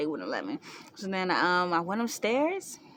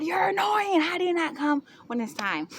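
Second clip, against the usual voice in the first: high, loud complaint; acted exasperation; 9 semitones higher, 4 dB louder than usual. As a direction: scold the absent father, hammed up. Retells annoyance at absent father.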